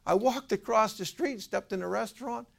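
high-pitched, breakneck-eager caller voice; excited retelling. Retold or imitated. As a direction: imitate an excited friend explaining. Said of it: "He was so excited".

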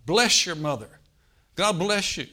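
loud blessing called over the room; calling out from the crowd. Retold or imitated. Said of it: "the woman in the crowd called out".

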